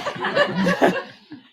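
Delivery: very high airless laugh; high breathy laugh. Real, not acted. Laughing at the memory.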